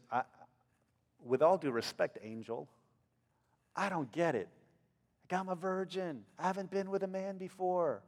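soft, hesitant, respectful; timid Mary. Acted. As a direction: say it quietly to someone far above you. Mary speaking timidly to the angel.

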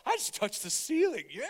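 high, thrilled exclamation; excited. Retold or imitated. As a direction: blurt it out like a kid who just jumped. Retelling his own thrill.

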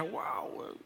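wow susurrado de madre maravillada; susúrralo maravillada, muy bajito; asombro susurrado. Retold or imitated. Madre maravillada con su bebé. (susurro de asombro imitado)

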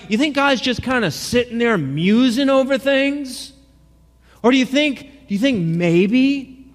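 high, mocking preacher taunt; sarcastic taunt. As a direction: needle them with thick sarcasm. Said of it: "The sarcasm is thick".

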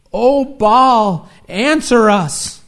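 loud, high pleading call, slowing on answer us; desperate pleading cry to an idol; crying out to Baal. Acted. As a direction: beg a silent god at full voice. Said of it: "crying out to Baal".

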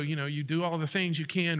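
ordinary talk, nothing marked

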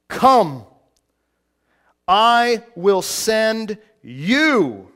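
slow, loud, weighty reading; voice of God. Acted. God commanding Moses, slow and loud. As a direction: proclaim each phrase like God calling out. Preacher quoting God's call to Moses.

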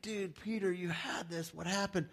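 tender tease to a friend who slipped; gentle chiding. Pretend. Jesus chides Peter again.

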